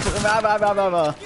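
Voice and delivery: imitating a friend's empty ranting; mocking blather. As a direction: parody someone ranting on. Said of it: "He's like".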